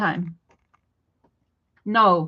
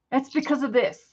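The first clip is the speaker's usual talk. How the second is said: ordinary talk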